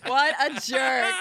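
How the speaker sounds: laughing. Real, not acted. Host got by a joke.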